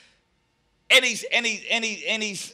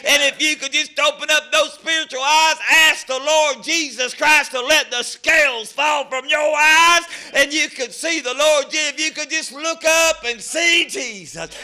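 fervent preaching; 5 semitones higher, 3 dB louder than usual. Real, not acted.

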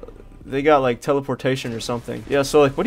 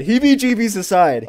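"heebie-jeebies aside" said again, higher and louder; louder repeat; 6 semitones higher, 6 dB louder than usual. Shown as a demonstration. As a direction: push the repeat up, insisting. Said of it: "Bro, heebie-jeebies aside".

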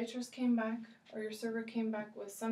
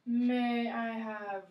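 slow modeled phrase; 5 dB louder, slower than usual. Shown as a demonstration. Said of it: "teacher models slow request".

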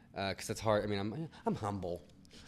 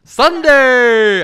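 very high, very loud announcer yell; announcer shout; 24 dB louder than usual. Pretend. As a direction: shout it like a TV promo. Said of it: "that's what they say on the Today Show".

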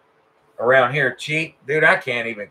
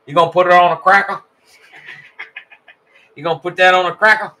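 fast, higher, laughing as he asks; laughing tease; 4 semitones higher, 5 dB louder, faster than usual. Real, not acted. Teasing a viewer about the cracker guy, laughing fast. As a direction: giggle through the joke question.

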